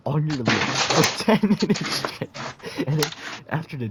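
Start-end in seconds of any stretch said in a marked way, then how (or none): 0.1-2.3 loud, cracking up mid-sentence; laughing while talking. Real, not acted. Storyteller losing it at own crude story, laughing. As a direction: push the words out through laughter.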